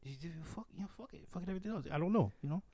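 rough imitation of a Mexican accent; offhand advice in a loosely done Latin accent. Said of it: "I can't do the accent very well".

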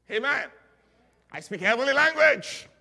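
very loud, high-pitched proclamation; exultant preaching shout. Real, not acted. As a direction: burst out at full preaching force. Preacher bursts out after tongues.

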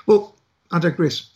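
usual speaking voice

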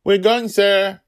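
shouted loud and high, a sharp call. A narrator's reading. Dragonlil shouting at the elderly goblin, loud and high. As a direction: yell it out to the old goblin. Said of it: "Dragonlil shouted".

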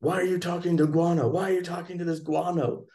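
mock annoyed woman. Woman scolding the leader for chatting with him, mildly mock annoyed. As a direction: huff at him for talking to the outsider. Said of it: "mad that he was talking to me".

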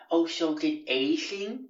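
mock a slow, awkward questioner; imitating Justin. Housemate's odd voice, mangling name.

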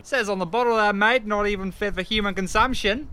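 high Aussie bloke scoffing; mock the beer in a broad Australian voice; Australian accent. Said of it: "the Aussie way".

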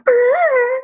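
high trembling brrr of fear; shivering brrr. Retold or imitated. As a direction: shudder out a scared brrr.

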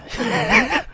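high-pitched chuckle at oneself; laugh. Real, not acted. Host admitting he can't take the weather, laughing. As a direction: chuckle high after a confession. Laughs at his own complaining.

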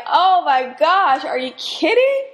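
high, loud disbelieving joy; thrilled, can't believe the good news; delighted astonishment, rising high; amazed. Acted. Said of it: "we can now be like".

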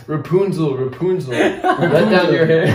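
laughing mock storybook call; quoting the fairy tale. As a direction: call up to the tower, half laughing. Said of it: "I remember that line in Rapunzel".

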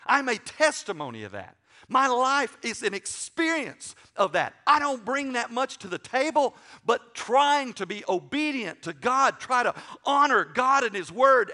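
full-force preaching, loud and high; impassioned preaching. Real, not acted. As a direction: belt out a heartfelt testimony.

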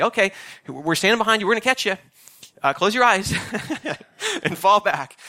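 a light impression of cheerful camp staff; camp counselor voice. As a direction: coax the kid to fall back. Camp counselors at trust fall.

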